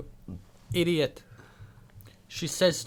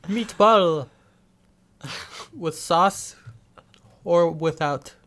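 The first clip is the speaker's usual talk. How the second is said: put-on Italian accent; 3 semitones higher than usual. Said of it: "I was doing Italian".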